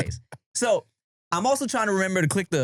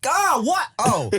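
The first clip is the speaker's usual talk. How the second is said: high-pitched exasperated outburst, played up; pissed-off phone answer; 8 semitones higher, much slower than usual. Reenacting answering a call mid-task, irritated high outburst. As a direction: snap it like an interrupted person answering a call. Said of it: "I think I answered the phone like this".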